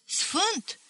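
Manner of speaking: high-pitched astonished echo; incredulous question. A narrator's reading. Listener doubts the priest is a saint, incredulous. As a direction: echo the word in disbelief.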